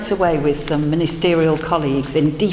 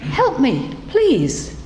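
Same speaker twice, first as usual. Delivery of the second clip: high, plaintive cry from nowhere; small voice calling for help; 9 semitones higher, slower than usual. A narrator's reading. Unseen frog calls out to the passing woman, high and pleading. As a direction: call out thinly, pleading to be found. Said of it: "calling out".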